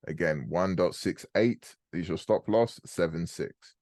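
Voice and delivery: ordinary delivery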